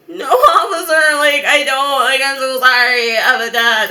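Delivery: mock crying plea to an officer; tearful panic.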